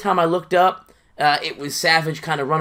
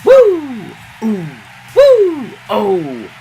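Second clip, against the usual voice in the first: loud high cheering whoops; excited whoop; 6 semitones higher, 9 dB louder, much slower than usual. Real, not acted. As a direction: whoop at the big spot. Excited cheering at a big move.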